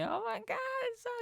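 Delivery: high falsetto gush; mock girlish squeal of joy; imitating happy girl. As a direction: gush it in her high, delighted voice. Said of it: "she's so happy".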